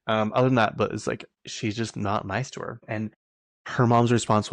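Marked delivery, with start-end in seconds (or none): none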